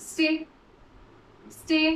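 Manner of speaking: normal talking voice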